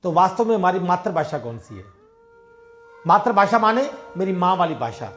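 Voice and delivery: normal talking voice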